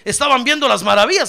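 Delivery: usual speaking voice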